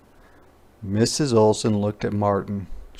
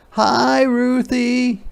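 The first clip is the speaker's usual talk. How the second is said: high, bright greeting; a mother welcoming her child; high cheerful mother; Mama greeting; 13 semitones higher, 4 dB louder, much slower than usual. Acted. Mama voiced.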